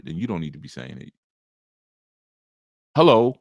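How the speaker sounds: ordinary talk, nothing marked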